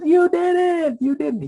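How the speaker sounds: very high, loud mock squeal; retold excited cry. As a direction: imitate an overjoyed little boy's cry. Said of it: "He's so excited".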